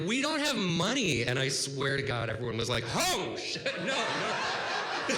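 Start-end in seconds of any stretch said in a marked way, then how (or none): 0.1-1.2 high, loud mock-desperate cry; frantic confession. Frantic confession to the hospital staff. 3.0-5.2 high-pitched mock alarm; shocked reaction. Staff's shocked reaction, acted out.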